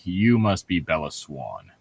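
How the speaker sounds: usual speaking voice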